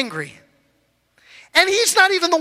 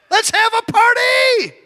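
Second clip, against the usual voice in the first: excited shout; 5 semitones higher than usual. Real, not acted. Preacher rallying the congregation, joyful shout. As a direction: whip the crowd up into celebration. Repeats louder, congregation cheers.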